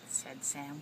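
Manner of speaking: usual speaking voice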